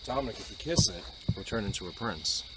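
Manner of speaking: ordinary talk, nothing marked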